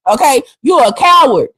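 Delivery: ordinary talk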